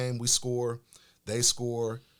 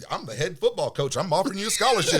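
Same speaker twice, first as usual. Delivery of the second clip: boastful coach; 9 semitones higher, 9 dB louder than usual. Retold or imitated. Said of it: "And I'm like".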